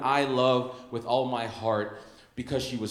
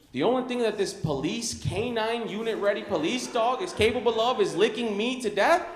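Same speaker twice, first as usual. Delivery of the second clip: incredulous mock disbelief; 7 semitones higher than usual. Incredulous retort to owner.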